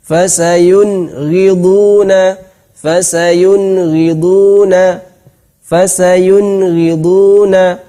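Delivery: Fasayun ghiduna slow, clear nun before ghain; Quran recitation. Shown as a demonstration. Teacher repeating the ghain example for students. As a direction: chant Fasayun ghiduna slowly. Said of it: "seperti itu".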